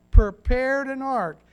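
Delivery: a booming, deliberate "prepared an ark"; emphasized phrase. Shown as a demonstration. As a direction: lean hard and slow on "prepared an ark". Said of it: "I wanna emphasize the word prepare".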